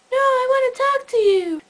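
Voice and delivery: high-pitched imitation of a pleading caller; mock pleading. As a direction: plead like a sleepy friend clinging to the call. Imitating sleepy caller protesting.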